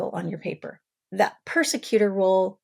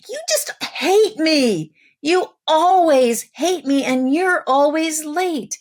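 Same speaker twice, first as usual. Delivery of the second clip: put-on much higher, louder, slow childish wail; yelling child; 9 semitones higher, 6 dB louder, slower than usual. Child yelling back at the parent, high loud wail. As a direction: cry it out like a hurt kid. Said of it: "Child yells".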